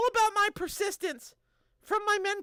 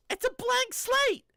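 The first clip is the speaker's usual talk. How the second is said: slow high emphasis; 8 semitones higher, 4 dB louder, slower than usual. Shown as a demonstration. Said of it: "repeated for emphasis".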